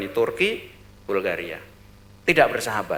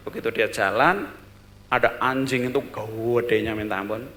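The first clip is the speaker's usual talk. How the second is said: ordinary delivery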